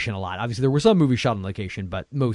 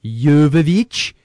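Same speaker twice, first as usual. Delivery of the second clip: Woody Woodpecker Swedish walrus voice; 2 semitones higher, 7 dB louder, much slower than usual. Acted. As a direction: stretch the name like a silly Swedish cartoon. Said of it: "in the Woody Woodpecker Swedish Walrus voice".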